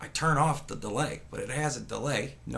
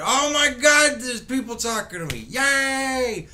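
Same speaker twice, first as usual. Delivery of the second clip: mock excited squeal; 9 semitones higher, 6 dB louder than usual. Mocking the president's eager delight.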